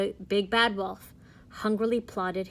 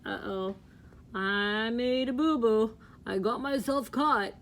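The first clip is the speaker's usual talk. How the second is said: sheepish wolf. Acted. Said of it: "does he look like".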